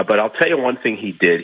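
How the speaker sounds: ordinary talk, nothing marked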